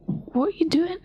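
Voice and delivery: high, startled question; alarmed. Retold or imitated. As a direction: recoil from a stranger reaching for you. Stranger reaches around her.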